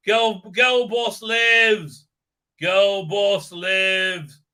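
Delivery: very slow, loud mock-triumphant declaration; mock proclamation. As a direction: proclaim it grandly, drawn out.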